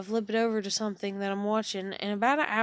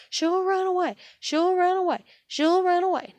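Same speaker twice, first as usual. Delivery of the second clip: much higher and louder, insistent alarm; mock panicked grandmother; 10 semitones higher, 7 dB louder than usual. Mimicking grandmother who fears the dog escapes, high alarmed cry. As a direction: squeal it like a fussing old lady.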